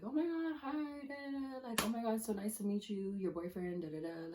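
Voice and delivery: mocking high sing-song of people meeting the new boyfriend; gushing fake-nice friends. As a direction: squeal the greetings up high like fawning acquaintances. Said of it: "They're like".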